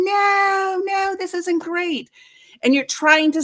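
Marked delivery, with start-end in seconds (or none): 0.0-2.1 high, slow wail of protest; stretched, high-pitched mock scream; imitated scream. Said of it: "I'm screaming, going".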